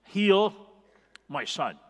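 loud desperate plea, pitch jumping up then dropping; a father begging Jesus, crying out loud to heal his son; pleading cry. Acted.

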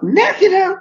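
very high, disbelieving squeak; imitating his own horrified question; retold shock. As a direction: spike up in alarm on the word. Hearing test result, shocked.

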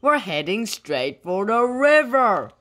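mildly panicked warning; alarmed call. A narrator's reading. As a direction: call out the danger ahead. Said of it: "called Wolf".

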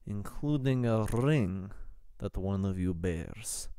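put-on measured Russian-accented prelate; grave official in a Russian accent. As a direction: speak formally with an Eastern European accent. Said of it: "at the Russian accent".

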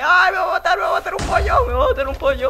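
high frantic squawking chicken; panicked chicken voice. Pretend. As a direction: shriek like a scared chicken.

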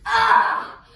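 loud, high "ah" scream; mock scream. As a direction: scream as the wolf pounces. Red as the wolf pounces.

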